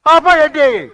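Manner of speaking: high, loud furious yell; explosive, high-pitched order; angry shout. Acted. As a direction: bellow at them to stop. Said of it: "బాబా అలా అరిచారెందుకు".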